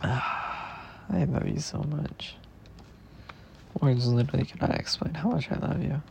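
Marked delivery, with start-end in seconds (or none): 0.0-2.4 tender. Real, not acted. Tender confession after a sigh.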